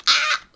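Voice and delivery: short, loud, high yell; startled scream. Real, not acted. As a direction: cry out in sudden alarm. Startled by player in game. (cri bref et aigu)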